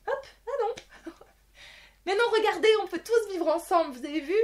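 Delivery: high, sing-song naive cheer; hammed-up happy ending voice, high; mock cheerful voice. Mocking Disney's happy ending.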